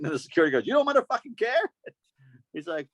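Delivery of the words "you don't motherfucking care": high, disbelieving repeat; incredulous echo. Retold or imitated. Security guard mocking the drunk fan's words, high. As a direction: throw his words back at him.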